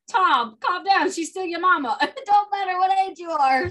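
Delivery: high, loud mock telling-off; mock chiding. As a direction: chide him with a laugh creeping in. Acts out chiding the actor.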